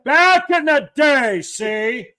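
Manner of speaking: put-on loud, high ranting old-timer; hammered-up impression, loud with stretched words; Don Cherry. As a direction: lecture the kids at full volume. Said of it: "I sound like Don Cherry".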